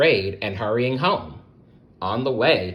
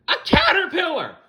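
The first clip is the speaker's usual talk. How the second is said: very high, loud startled shriek; frightened yell; 5 dB louder, faster than usual. Acted. Webster startled by Hermie, shrieking. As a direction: yell back in equal fright. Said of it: "Webster yelled".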